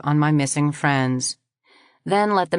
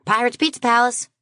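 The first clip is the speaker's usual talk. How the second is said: higher, bright shop greeting; cheerful phone greeter; 8 semitones higher than usual. Acted. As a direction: answer the phone like a pizza shop. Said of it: "the friendly voice".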